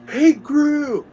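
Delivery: high, loud cartoon exclamation; hammed-up high cry of disbelief; mock shock. Bad guys react to giant hero.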